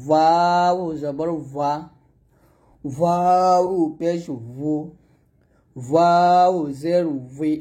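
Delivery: ওয়াও drawn out very slowly; slow model recitation, long glide in ওয়াও; rounded-lip Waw. Shown as a demonstration. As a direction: intone each ওয়াও long, lips rounded. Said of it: "ঠোঁট থেকেই ওয়াওটা উচ্চারণ করতে হবে".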